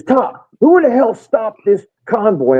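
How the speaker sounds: high, slow, indignant demand; fired up. Retold or imitated. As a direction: imitate a fired-up soldier demanding answers. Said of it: "he is fired up".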